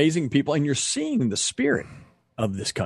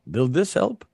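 light imitation of a thick Ukrainian accent. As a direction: ask it gently in a hint of a Ukrainian accent. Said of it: "great accent".

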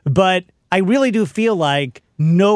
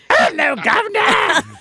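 high, slow, hammed-up British "Hello, Governor"; British accent; slower than usual. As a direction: stretch "Governor" out in a mock Cockney-British voice, called out high. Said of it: "can you do a British accent?".

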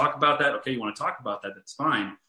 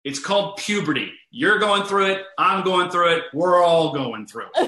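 loud, higher mock proclamation; imitated blunt announcement; 5 semitones higher, 6 dB louder than usual. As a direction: call it out like a kid setting his friends straight. Friend calls out from next room.

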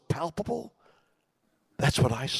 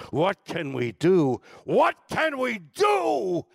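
building from slow and loud to a high, slow shout; rising cry; 9 semitones higher, 4 dB louder, slower than usual. Retold or imitated. Recalling the Kennedy Center song, building to a shout. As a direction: swell it into a rallying cry. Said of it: "are the lyrics".

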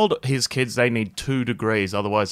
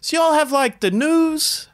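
much higher, slow, drawn-out naive question; mock clueless wife; 3 dB louder, slower than usual. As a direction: stretch out "the news?" like she's clueless. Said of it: "his missus goes".

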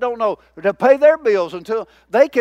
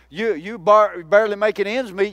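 ordinary talk, nothing marked

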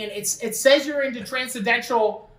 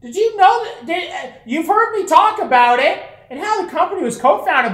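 excited pitch; 8 semitones higher, 6 dB louder than usual. Pretend.

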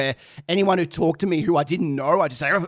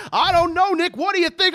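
high, excited commentary voice; imitated high-pitched announcer; commentator; 12 semitones higher than usual. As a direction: throw it back like a hyped announcer.

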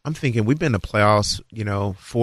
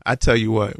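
ordinary talk

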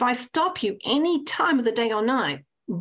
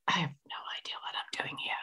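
途方に暮れた小声のささやき; lost and helpless, breathed out without voice; whisper; 5 semitones lower, 12 dB quieter than usual. Retold or imitated. Clueless on the tennis court.

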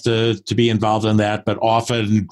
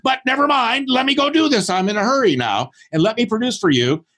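eager brush-off; 13 semitones higher than usual. Retold or imitated. Acted reply brushing off the client.

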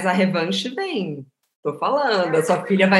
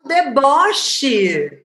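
higher, louder outburst; excited exclaim; 6 semitones higher, 3 dB louder than usual. Real, not acted. Host cheering at the teasing in the story, excited. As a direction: call it out with delight.

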